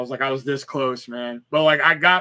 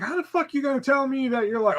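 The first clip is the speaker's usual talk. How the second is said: higher-pitched, incredulous outrage; indignant; 9 semitones higher than usual. Retold or imitated. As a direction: demand an answer, pitch jumping up. Acted indignation after snapping.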